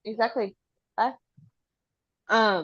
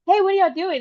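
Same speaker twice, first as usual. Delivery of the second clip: high, bright mock kid voice, louder; cheerful child voice; 10 semitones higher, 4 dB louder than usual. Mom imitates daughter suddenly cheerful, high mock kid voice. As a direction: switch instantly to a happy distracted child. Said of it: "she's like".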